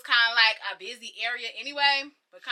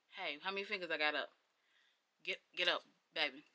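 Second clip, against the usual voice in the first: a lower, softer voice coaxing her awake; gentle bystander; 7 semitones lower, 11 dB quieter than usual. Retold or imitated. Bystander waking a knocked-out girl, soft low coaxing. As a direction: coax a knocked-out girl to get up, lightly voiced.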